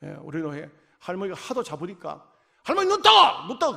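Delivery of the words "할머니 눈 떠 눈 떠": loud, high wake-up call. Retold or imitated. Preacher calls to a dozing grandmother, loud and high. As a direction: jolt the dozing grandmother awake.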